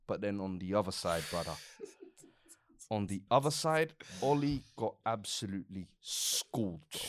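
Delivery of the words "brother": ordinary talk, nothing marked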